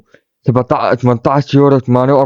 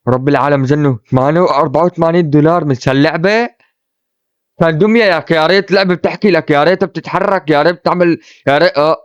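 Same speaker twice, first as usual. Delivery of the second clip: normal talking voice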